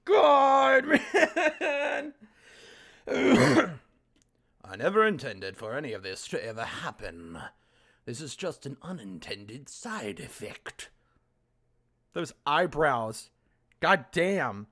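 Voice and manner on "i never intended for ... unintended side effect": lower, soft voiced girl pleading; low voiced Monika. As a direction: read her line low and quiet. Voicing Monika in visual novel.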